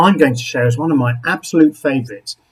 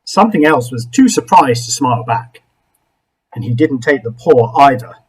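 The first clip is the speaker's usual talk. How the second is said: plain reading voice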